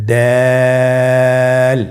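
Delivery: দাল with the vowel held three counts; Arabic letter with three alif lengthening; three alif stretch. Shown as a demonstration. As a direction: draw দাল out long and thin. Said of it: "তিন আলিফ টেনে পড়ব".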